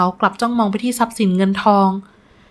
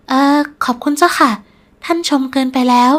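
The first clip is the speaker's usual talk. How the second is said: higher, hesitant polite voice; nervous young woman; 4 semitones higher than usual. Acted. Bride-to-be answering the nobleman's praise, nervously modest. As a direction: demur politely, pitched up.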